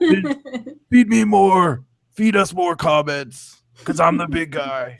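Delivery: big-guy wrestler promo, drawn out; deliberate wrestler catchphrases, stretching the demand; slow mock wrestling voice; Ryback impression. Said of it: "my Ryback is more like".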